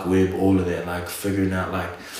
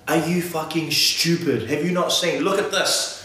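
higher-pitched, incredulous mock argument; mock indignant rebuttal; 6 semitones higher than usual. Imitating people rebutting flat-earth talk, mock indignant. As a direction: argue back in disbelief, half in jest.

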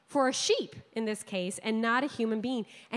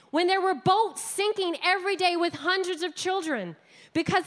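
higher, louder, pressing the point home; impassioned preaching; 7 semitones higher, 4 dB louder than usual. Acted. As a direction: preach it with rising outrage.

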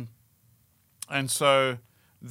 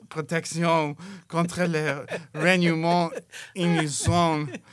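mock seductive French; 3 semitones higher, 5 dB louder, slower than usual. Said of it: "just go".

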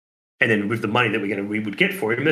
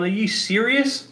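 high, slow incredulous question; disbelief; 11 semitones higher, slower than usual. Retold or imitated. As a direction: stretch it out in disbelief. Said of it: "I'm sitting there going".

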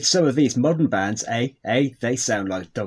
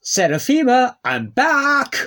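calling out loud; 14 semitones higher, 6 dB louder, slower than usual. Acted. Husband calls out arriving home.

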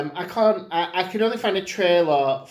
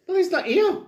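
mock filmmaker. Mimics the filmmakers' deadpan reply.

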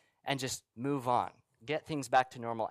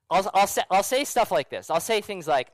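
ordinary delivery

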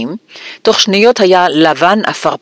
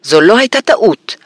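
higher-pitched indignant retort; angry; 6 semitones higher than usual. A narrator's reading. Boy disputing his uncle's excuse, indignant high voice. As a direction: contradict him with some anger. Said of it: "אמר הארי בכעס".

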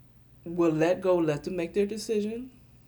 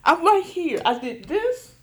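much higher, laughing shock; high mock disbelief; 14 semitones higher, 7 dB louder than usual. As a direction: squeal in amused disbelief at the baby. Retelling son fell off bed.

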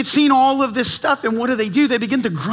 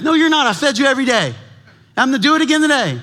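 exasperated parent; 4 semitones higher, 3 dB louder, much faster than usual. Retold or imitated. Parent answering grumbling kids.

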